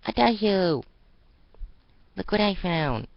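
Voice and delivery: high, loud gleeful call; excited call. Acted. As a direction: rush over with your find. Excited girl runs over.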